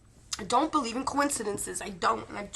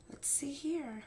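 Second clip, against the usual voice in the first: whisper; 4 semitones higher, 10 dB quieter, slower than usual. Real, not acted. Starting the spirit session.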